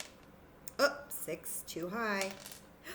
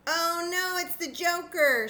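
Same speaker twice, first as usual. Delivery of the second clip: put-on loud, high oh no for kids; mock dismay; 11 semitones higher, 7 dB louder than usual. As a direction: ham up the bad luck. Mock dismay drawing joker.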